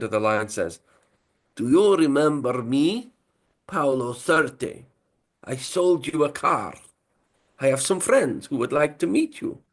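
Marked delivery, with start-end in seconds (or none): 1.6-9.6 slow, high mock Italian mobster accent. Italian accent, caller menacing the striker. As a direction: lay on a thick Italian accent, drawn out. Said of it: "That's Italian, by the way".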